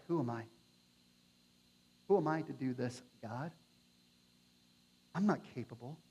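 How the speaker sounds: shrinking back from a task too big; hushed, defeated self-doubt; timid doubt. Acted. Insecure believer voiced timidly.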